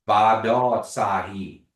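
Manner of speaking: Ba Do Tsai, slow and loud; syllable by syllable. Shown as a demonstration. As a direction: sound out Ba Do Tsai slowly, syllable by syllable. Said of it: "we say".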